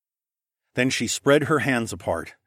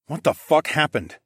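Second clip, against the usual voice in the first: restrained anger, slightly raised; simmering temper; 5 semitones higher than usual. Acted. Narrator confronting mage and falcon man, simmering. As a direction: demand it with temper held in. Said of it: "my temper simmered under the surface".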